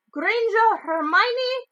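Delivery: high, loud, slow roll call; calling out a name. A narrator's reading. McGonagall calls Hermione up to the stool, slowly and loudly. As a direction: draw the name out across the hall.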